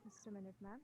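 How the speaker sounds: very faint, far from the mic; almost inaudible; barely audible. Real, not acted. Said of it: "It's again very low".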